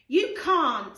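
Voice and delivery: put-on loud childish veto; sharp refusal. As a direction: bark it like a bossy child. George blocking Freddie.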